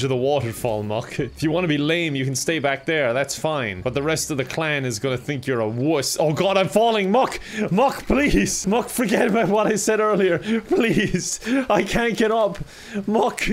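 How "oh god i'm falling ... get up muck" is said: panicked calls. Real, not acted. Falling, calling companion for help.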